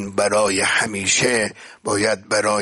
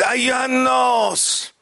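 calling out; 13 semitones higher, 3 dB louder than usual. Retold or imitated. Preacher as the Prophet calling the mosque's people, high ringing cry. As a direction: cry out to gather a crowd, imitating the Prophet. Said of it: "داد زد، همهٔ اهل مسجد".

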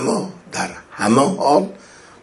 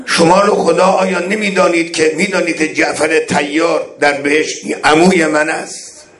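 higher, louder pleading call to the army; imploring imam; 6 semitones higher, 4 dB louder than usual. Acted. Imam calls out to the enemy army, higher and louder beseeching. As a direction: beseech them by God to recognize you. Voices Imam's oath-pleas.

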